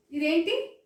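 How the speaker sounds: ordinary talk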